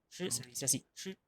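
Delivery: "shi" en primer tono, sostenido y parejo; primer tono chino. Shown as a demonstration. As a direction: di "shi" con el primer tono del mandarín. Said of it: "con primer tono". ("shi" en primer tono del chino)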